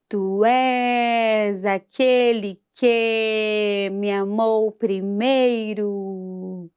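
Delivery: slow, syllable by syllable in pulse, "és" and "amou" marked; spoken syllable division. Shown as a demonstration. Teacher divides the hymn line into syllables. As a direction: count out each syllable of "tu és aquele que me amou primeiro". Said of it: "Mais uma vez".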